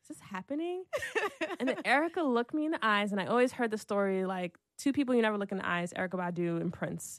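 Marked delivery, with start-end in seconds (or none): 0.0-0.8 high, soft mock-stunned question; hushed disbelief. Imitating her friend watching the meeting, hushed disbelief.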